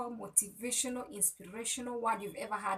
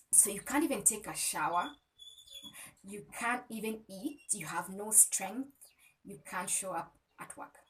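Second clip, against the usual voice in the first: ordinary talk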